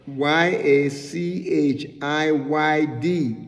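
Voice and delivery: letter by letter, slowly; spelled out. Shown as a demonstration. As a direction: spell "Y A C H I Y D" one letter at a time. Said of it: "it's spelled".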